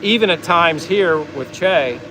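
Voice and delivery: ordinary talk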